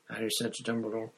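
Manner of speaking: plain reading voice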